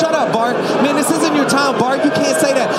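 high, loud rebuke; acted bystanders hushing him at full volume; scolding crowd. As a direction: shout him down over the noise. Crowd rebukes the shouting beggar.

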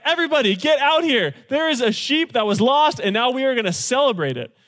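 high, loud party call; excited shout. Retold or imitated. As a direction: shout for everyone to come celebrate. Shepherd calling friends to party.

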